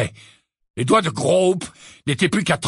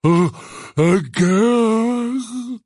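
halting start, then a long raised bellow; insistent giant; 7 semitones higher, 3 dB louder, much slower than usual. Acted. Giant searching among the centaurs, insistently bellowing. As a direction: repeat it insistently as a lumbering giant. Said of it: "répéta-t-il d'un ton plus insistant".

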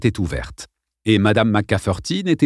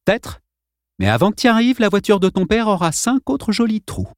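cool threat; 11 semitones higher than usual. A narrator's reading. Boy coolly threatens bully.

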